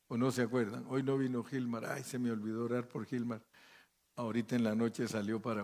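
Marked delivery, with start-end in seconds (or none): none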